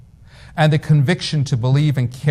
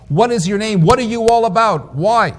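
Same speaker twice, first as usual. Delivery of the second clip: higher, urgent questioning; raised voice of a searcher demanding an answer; pressing, searching questions; 8 semitones higher, 3 dB louder than usual. Retold or imitated. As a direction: push the questions up high, insistent. Jacob urgently questions the angel.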